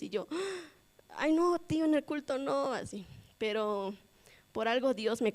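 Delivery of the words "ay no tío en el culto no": high, whiny mock protest; pleading higher, half-joking; dismayed protest.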